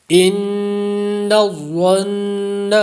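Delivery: ان الظن recited very slowly with long held ghunna on both doubled nuns; tajweed recitation. Shown as a demonstration. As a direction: hold ghunna twice in ان الظن. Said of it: "نون مشدد دو جگہ پر ہے".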